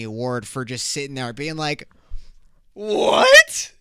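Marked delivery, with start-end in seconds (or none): 2.7-3.7 shrieking high, loud mock astonishment; fake shocked disbelief. To a friend coming out, a hammed-up shocked yell. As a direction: overact stunned surprise for an Oscar. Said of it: "I said it just like that".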